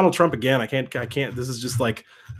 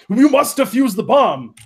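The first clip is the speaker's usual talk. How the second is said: put-on high, loud Austrian-accented action hero; mock Austrian accent, urgent order; Arnold; 8 semitones higher, 4 dB louder than usual. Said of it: "I also got the Arnold California down".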